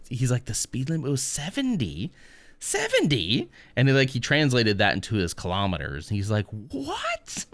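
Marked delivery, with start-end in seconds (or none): 0.4-3.5 incredulous question swooping up high; mock disbelief. Retold Irishman's shock at speed limit. 6.5-7.4 very high, softer squeak of disbelief; mock astonishment. Retold shock at converted speed.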